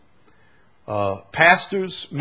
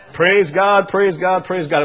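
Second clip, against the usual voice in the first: louder parroted praise, same tune as dad; mock parrot repeating praise God; parrot mimicking dad; 4 semitones higher, 8 dB louder than usual. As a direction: echo dad's words like a parrot. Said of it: "It would say it just like him".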